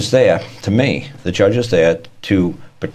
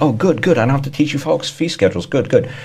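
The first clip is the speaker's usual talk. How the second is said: higher, gushing mock relief; 7 semitones higher than usual. Speaker mocking his own relief about fee schedules, higher and gushing. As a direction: sound pleased, a bit too pleased. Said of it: "So it's like".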